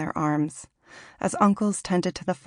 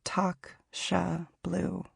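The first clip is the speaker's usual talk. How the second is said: slow unvoiced whisper of Toxshablu, stretched out; whispered incantation; 6 dB quieter, much slower than usual. Shown as a demonstration. Reader breathes her great-grandmother's name like an incantation, whispering. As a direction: breathe Toxshablu like a spell, drawn out and voiceless. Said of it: "Like an incantation".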